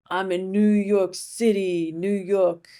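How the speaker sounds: slow, hammed-up New York accent; terrible New York accent. Dad visiting New York, doing his terrible New York accent. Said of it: "just the worst New York accent".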